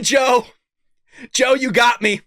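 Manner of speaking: ordinary delivery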